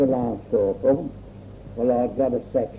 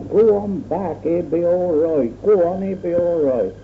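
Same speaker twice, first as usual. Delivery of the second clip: higher, mock shouted call across the farm; retold shout; 6 semitones higher than usual. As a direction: call him back, a hint of urgency. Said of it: "this bloke shouted back".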